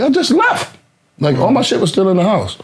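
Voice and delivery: high, stunned protest; retold shocked disbelief. Recalling his reaction to the shooting news, shocked disbelief. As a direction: leap up in pitch, unable to believe it.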